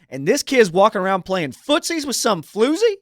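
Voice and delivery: outraged. Acted. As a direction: blow up at the scandal.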